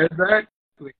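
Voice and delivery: loud, high, emphatic agreement; excited. Real, not acted. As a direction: burst out with it. Hearty agreement with partner.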